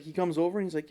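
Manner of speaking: usual speaking voice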